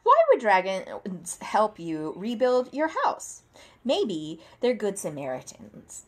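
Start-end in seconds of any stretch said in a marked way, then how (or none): none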